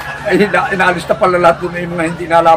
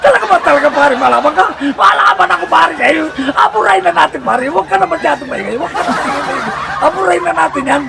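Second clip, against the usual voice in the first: much higher, indignant buddy voice; challenging barkada; 10 semitones higher than usual. Acted. Friend insisting he's not afraid of the lister, high and indignant. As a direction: bristle at being called a coward.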